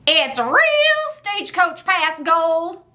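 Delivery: high, slow, hammed-up Southern twang; cheesy Southern accent. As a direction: put on a corny Southern accent, drawn out. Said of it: "with a cheesy Southern accent".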